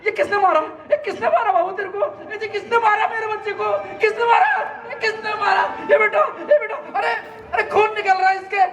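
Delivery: mother crying over her beaten son; frantic mother. Acted. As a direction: fuss and sob over the hurt child.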